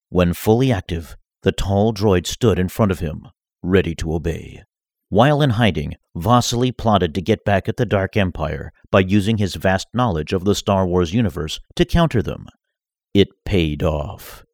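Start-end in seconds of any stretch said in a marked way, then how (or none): none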